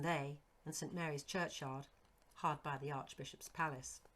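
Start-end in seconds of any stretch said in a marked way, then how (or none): none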